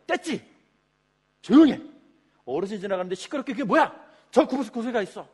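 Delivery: loud, high bursts of command; bully them into silence with a sudden shout; browbeating scold. Acted. Crowd scolding noisy beggars as an elder passes, browbeating. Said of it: "이렇게 지금 윽박을 지르고 있습니다".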